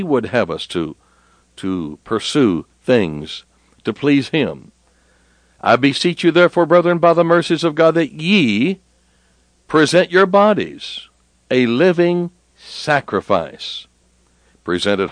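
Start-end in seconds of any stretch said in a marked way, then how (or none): 5.6-13.9 emphatic preaching. Shown as a demonstration. Rereads verse with emphasis.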